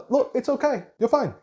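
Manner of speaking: mock parent telling the kid to get over it; dismissive parent. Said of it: "Does the parent say".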